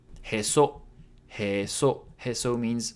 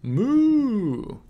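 high, held moo; long cow call pitched well up; cow's moo; 11 semitones higher, 4 dB louder than usual. Acted. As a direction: imitate a cow, stretching the vowel. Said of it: "says the cow".

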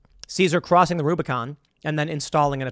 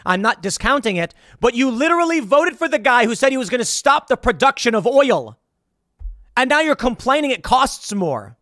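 exasperated retort; 6 semitones higher, 3 dB louder than usual. Retold or imitated. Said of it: "And I'm like".

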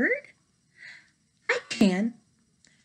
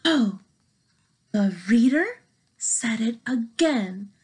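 usual speaking voice

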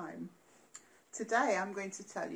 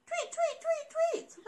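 very high tweets; birdsong tweets. Acted. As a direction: chirp like little birds. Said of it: "went the birds".

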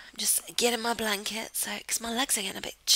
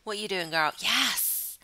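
ordinary talk, nothing marked

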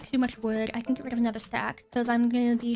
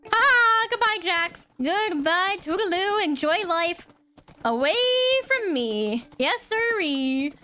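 gleeful taunt; 6 semitones higher, 4 dB louder, slower than usual. Real, not acted. Escaping Jax, taunting him.

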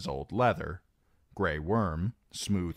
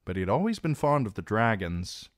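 ordinary delivery, nothing marked

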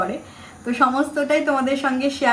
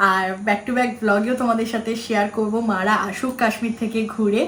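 normal talking voice

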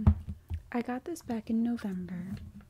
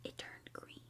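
awed whisper; 17 dB quieter than usual. Real, not acted. Slime turns green, gasp.

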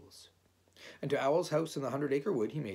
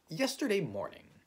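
raised, deliberate announcement; solemn; 9 semitones higher, 4 dB louder than usual. A narrator's reading. Rabbit beginning his report to Owl, solemn. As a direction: open the account with gravity. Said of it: "said Rabbit solemnly".

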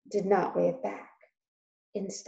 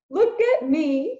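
mock king; 8 semitones higher, 6 dB louder, slower than usual. Uncle plays a king.

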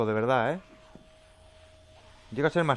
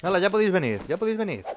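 higher cocky dare; taunting; 6 semitones higher than usual. Real, not acted. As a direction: dare the monsters to come. Taunting monsters after sealing. (reto chulesco: ya podéis venir)

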